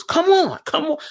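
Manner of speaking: put-on loud, high heavy New York accent. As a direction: bark it like a pushy New Yorker. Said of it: "with that real heavy New York accent".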